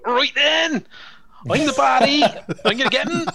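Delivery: a British baddie showing off in a West Country accent. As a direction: play the cartoon West Country bad guy. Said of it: "put on a West Country accent".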